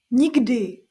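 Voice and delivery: a loud protective no; exclaimed. Acted. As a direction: cry it out, shielding the child. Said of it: "zvolala babička".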